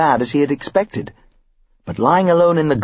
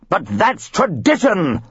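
slow, shrill protest; hammed-up screech; 6 semitones higher, slower than usual. A narrator's reading. Father defending tradition, screeching. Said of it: "Mister Elliot screeched".

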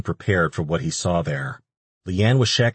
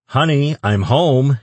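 loud, higher-pitched call into the house; yelled out; 7 semitones higher, 7 dB louder, slower than usual. A narrator's reading. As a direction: call out as if announcing your arrival. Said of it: "he yelled into the small house".

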